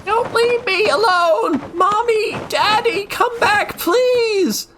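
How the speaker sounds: put-on very high sobbing child's scream; crying out. A narrator's reading. Abandoned girl screams after her parents, high sobbing cry. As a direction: wail after parents who are leaving.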